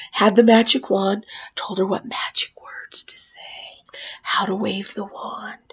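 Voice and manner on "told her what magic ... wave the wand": soft, near-whispered storytelling; hushed suspense. Acted. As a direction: drop to a hush for the magic moment. Hushed build-up to magic trick.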